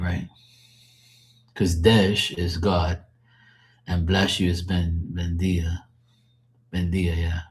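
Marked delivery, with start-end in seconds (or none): none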